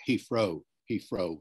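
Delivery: "Heathrow" said as "Heefrow". Shown as a demonstration. Teacher describing speech round the country, "Heathrow" with an F. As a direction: replace the TH in "Heathrow" with an F. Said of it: "with an F rather than a T H".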